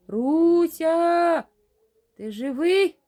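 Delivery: loud, high call through static; distorted radio-like shout. A narrator's reading. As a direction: shout the name as if over a bad radio. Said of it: "спотворений, неначе з радіоприймача голос".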